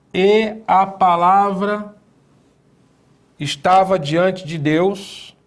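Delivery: slow, solemn reading of scripture. Acted. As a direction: read the verse slowly and reverently. Reads scripture translation.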